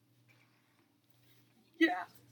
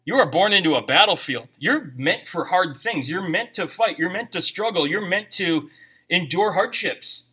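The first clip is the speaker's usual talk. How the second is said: usual speaking voice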